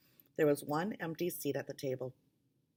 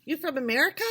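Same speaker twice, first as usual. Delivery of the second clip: eager question; 10 semitones higher, 6 dB louder, much faster than usual. A narrator's reading. Quito asks, higher louder.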